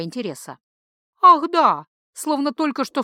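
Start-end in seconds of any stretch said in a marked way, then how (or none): 1.2-1.9 high, loud "oh, right!"; as if it just came to mind; bright surprised exclamation; sudden recollection. Acted. Priestess suddenly remembers.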